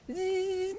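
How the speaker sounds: high, soft sustained buzz; energy zap noise. Shown as a demonstration. Showing the healing hand's power, imitated buzzing.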